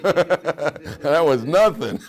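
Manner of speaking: laughing through the words, loud and slow; real laughter breaking into the line. Real, not acted. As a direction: crack up while saying it. Laughing while acting the husband.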